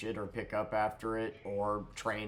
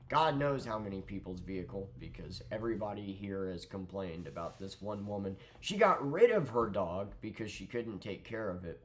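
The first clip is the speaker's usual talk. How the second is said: ordinary talk, nothing marked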